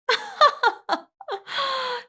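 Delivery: high, loud villainous cackle; evil cackle. Acted. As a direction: cackle in triumph like a vampire queen. Vampire queen mocks entranced victim.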